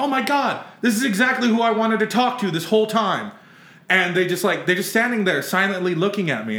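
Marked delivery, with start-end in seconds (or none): 0.0-3.3 higher-pitched, starstruck outburst; retold freak-out. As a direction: act out freaking out at meeting your idols. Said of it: "I just start freaking out".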